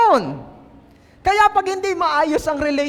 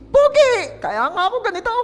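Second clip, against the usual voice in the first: exaggerated exclaim; 9 semitones higher than usual. Sister answering God with a high mock cry. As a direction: squeal it like a flustered sister. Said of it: "Hindi ba pogi si Pitts?".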